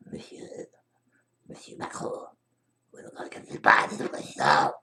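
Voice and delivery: hushed ASMR whisper; library whisper. Pretend. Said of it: "c'est un S M R".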